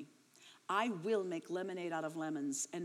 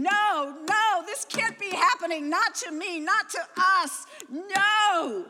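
much higher and louder, a desperate cry of no; anguished screaming; 11 semitones higher, 12 dB louder, slower than usual. Retold or imitated. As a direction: scream it out as if your world is collapsing.

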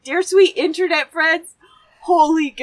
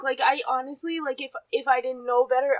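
ordinary delivery

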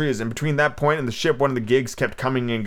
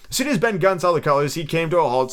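ordinary delivery, nothing marked

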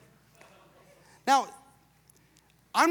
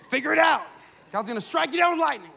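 loud, high parental outburst, hammed up; mock angry shout; 5 semitones higher, 5 dB louder than usual. Dad to crying daughter at church, mock furious yell. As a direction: scold a child at the top of your voice, playfully exaggerated.

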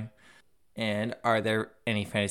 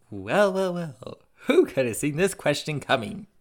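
high, playful "well, well, well" reveal; mock-dramatic; 6 semitones higher, 5 dB louder than usual. Host theatrically greeting a question just drawn, mock-dramatic. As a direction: feign knowing surprise, pitched up.

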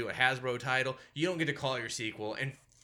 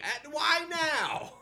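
incredulous wail; 13 semitones higher, much slower than usual. Retold or imitated. Ranting at the filmmakers.

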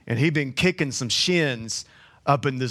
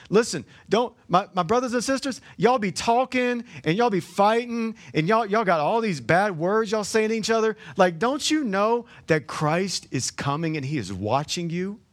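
higher, heated scolding of the church; scolding the squabbling believers, raised and heated; scolding preacher; 5 semitones higher than usual. Retold or imitated. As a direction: chew them out like a fed-up uncle. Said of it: "because he says".

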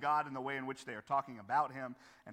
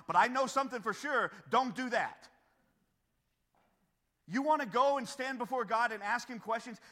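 raised, forceful admonishing; impassioned preaching; 7 semitones higher, 6 dB louder than usual. Real, not acted. Preacher warning congregation how to approach God, impassioned. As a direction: warn them firmly, pitched up and loud.

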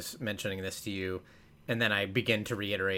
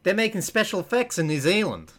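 high, animated New Zealand accent attempt; 8 semitones higher, 4 dB louder than usual. Podcaster testing the accent on a commercial line, high attempted New Zealand accent. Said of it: "I think that's something".

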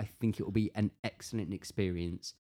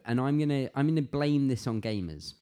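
usual speaking voice

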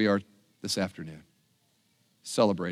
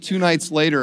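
normal talking voice

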